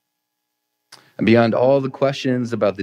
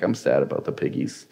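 mock sad toddler; 7 semitones lower, 5 dB quieter than usual. Pastor imitating his toddler Ruby, sad about the pigs, soft and lower. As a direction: lower and softer, a small pouting lament. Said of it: "She's, she's a feeler".

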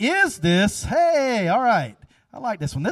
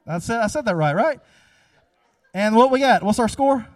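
ordinary delivery

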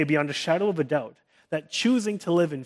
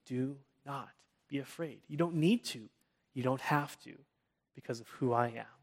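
very soft, gentle and hushed; a hushed voice comforting the anxious; still small voice; 11 dB quieter than usual. Shown as a demonstration. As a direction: speak quietly right to the soul. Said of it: "in that still small voice that speaks right to our soul".

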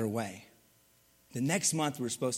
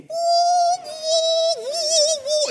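high piercing whistling woo, much louder; hearing aid whistle; 10 dB louder, slower than usual. Retold or imitated. Preacher mimics nursing home hearing aids whistling, a high loud whine. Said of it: "all their little hearing aids".

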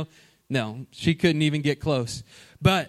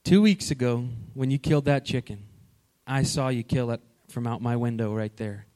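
calm father; 6 semitones lower, 5 dB quieter than usual. Retold or imitated. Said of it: "and he says".

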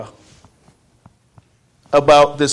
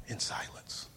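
breathy whispered echo; 21 dB quieter than usual. Real, not acted. Preacher echoing about trusting God in silence, whispered. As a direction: whisper it into the hush.

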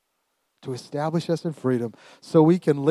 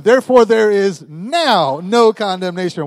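loud, raised voice, stamping on "now"; emphatic; 6 semitones higher, 8 dB louder than usual. Shown as a demonstration. Preacher leading the stamping congregation again, emphatic "now". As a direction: proclaim it and hit "now" hard. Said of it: "When you get emphatic".

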